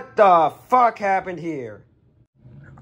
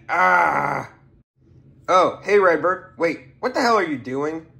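ordinary talk

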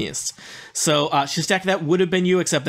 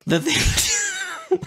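squeaky high-pitched echo. Pretend. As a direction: squeak it back as a joke. Echoing the joke title.

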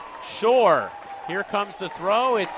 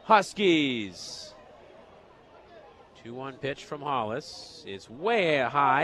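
ordinary talk

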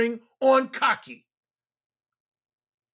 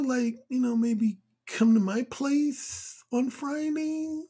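slow, halting, full of hesitations; unconfident; slower than usual. Acted. Man asking Cynthia out with little confidence, hesitant. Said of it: "average or less than average level of confidence".

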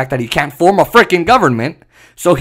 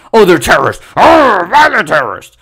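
hammed-up, mock-alarmed outburst; mocking outcry; 4 dB louder than usual. Mimicking those who accuse protesters, mock outrage. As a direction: blurt it as an overblown accusation.

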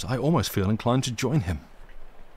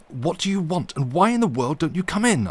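loud, high, irritated call through the door; cried out; 8 semitones higher, 5 dB louder than usual. A narrator's reading. Narrator calling to someone outside his door, loud and annoyed. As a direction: shout it out in vexation. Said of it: "I cried out".

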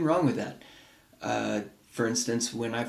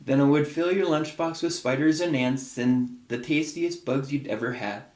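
gentle picture-book read-aloud; reading to children. Acted. Parent reading a picture book to a child, storytelling voice.

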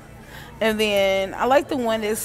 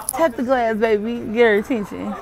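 ordinary talk, nothing marked

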